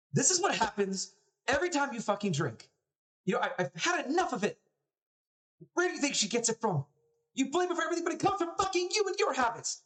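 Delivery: very high, heated accusations; quarrelling mother. Acted. Mother fights with dad about his drinking, overheard and angry. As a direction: rail at your husband, pitch way up.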